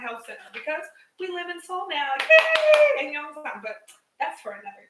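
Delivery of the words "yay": a squeaky high, loud little cheer; mock yay. Real, not acted. Mom mentions living in Seoul now, squealing a high loud yay. Sarcastic cheer about moving.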